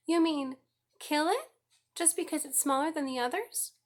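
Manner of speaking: high, loud, horrified cry; shrieking girl. A narrator's reading. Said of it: "shrieked Fern".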